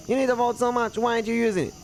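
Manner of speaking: high, pressing character voice; insistent guy. Acted. Guy challenging someone over the unused vault, insistent.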